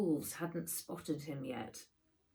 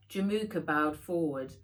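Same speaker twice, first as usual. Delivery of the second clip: ordinary delivery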